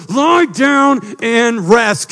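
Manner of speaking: slow, loud, high drawn-out order; commanding Jesus. Pretend. Jesus ordering the restless to rest, slow loud command. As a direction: stretch each word like a firm command. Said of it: "He goes".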